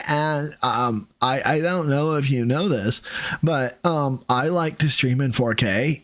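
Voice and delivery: ordinary talk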